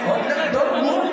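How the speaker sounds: usual speaking voice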